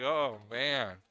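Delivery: loud, drawn-out mock dismay; imitated dismay. Commentator mimics the player stuck with a bad shot, loud mock dismay.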